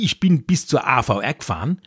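ordinary delivery

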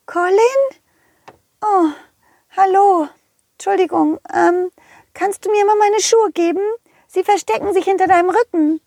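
put-on high girlish fairy voice; sweet little fairy asking politely. Fee spricht freundlich. (hohe Feenstimme)

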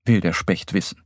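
ordinary delivery, nothing marked

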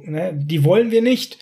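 ordinary talk, nothing marked

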